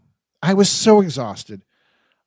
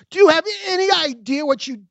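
much higher, accusing; angry demand; 10 semitones higher than usual. A narrator's reading. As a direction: demand an answer. Confronting the young couple.

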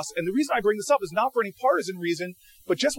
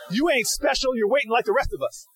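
indignant telling-off; 6 semitones higher than usual. Retold or imitated. Acting out indignant woman in voting line.

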